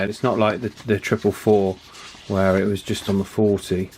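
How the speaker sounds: ordinary delivery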